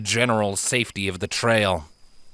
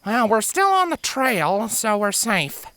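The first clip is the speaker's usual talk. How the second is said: reassuring filly; 13 semitones higher than usual. Acted. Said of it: "Apple Surprise pointed out".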